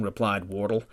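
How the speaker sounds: ordinary delivery, nothing marked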